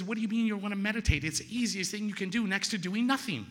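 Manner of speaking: high-pitched exasperated disbelief; incredulous protest. Retold or imitated. Sponsor to man refusing to meditate, incredulous protest. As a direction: argue back, high and incredulous.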